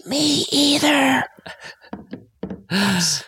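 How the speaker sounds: hushed stage whisper scream. Real, not acted. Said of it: "I'm stage whisper screaming".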